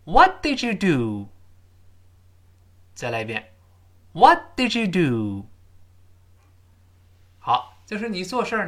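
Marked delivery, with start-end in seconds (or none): 0.0-1.3 slow loud question, high "What", drawn-out "do"; stress on What and do. Shown as a demonstration. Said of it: "音调和语气". 4.1-5.5 slow loud question, high "What", drawn-out "do"; high "What" falling to a long "do"; stress on What and do. Shown as a demonstration. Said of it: "音调和语气".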